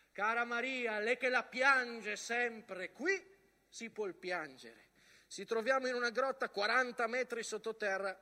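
letter read in anguish. Acted. A soldier writes home from the mud, loud anguished reading. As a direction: cry the letter out from the trenches. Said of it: "reading the soldiers' letters aloud".

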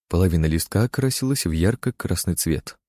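plain reading voice

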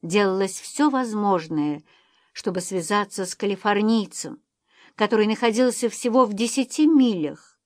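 usual speaking voice

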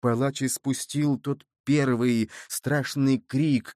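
ordinary delivery, nothing marked

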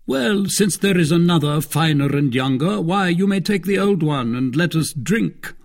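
high, loud, breezy concession; expansive Athos. Acted. Athos yielding the old horse and calling for wine, expansive. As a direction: settle it grandly and call for drink.

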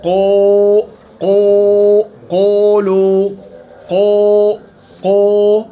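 قو with the vowel pushed toward waw, loud; wrong demo of over-heavy qaf; overdone qaf. Shown as a demonstration. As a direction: drag قو into a rounded waw sound. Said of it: "ليش تقرأ هيك يا أبو نضال؟".

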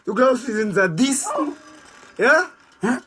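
very high and loud, hurling the questions at him; enraged, high-pitched yelling; furious shout. Acted. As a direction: scream the accusation back in his face. Prisoner confronts interrogator, enraged.

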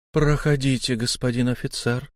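ordinary delivery, nothing marked

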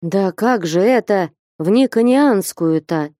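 ordinary delivery

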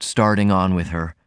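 ordinary delivery, nothing marked

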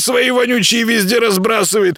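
high-pitched, scolding creature voice; grumpy little house spirit. Acted. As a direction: nag like an indignant tiny housekeeper.

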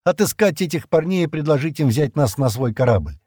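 ordinary delivery, nothing marked

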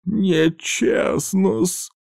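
high, slow, strained croak; pained wheeze. A narrator's reading. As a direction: wheeze it out through pain. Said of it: "прохрипел мужчина". (сдавленно, с болью прохрипеть)

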